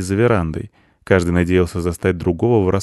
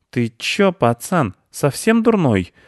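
raised, incredulous street-tough retort; indignant squirrel; 7 semitones higher, slower than usual. A narrator's reading. Squirrel snaps back at the boy, indignant. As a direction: let the last words climb in outrage. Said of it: "возмутилась белка".

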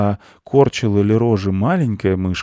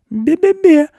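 very high, loud squeaky bleat; squeaked teasing; taunting tiny mouse; 8 dB louder, slower than usual. A narrator's reading. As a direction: jeer like a bratty little mouse. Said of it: "скорчила рожицу мышка".

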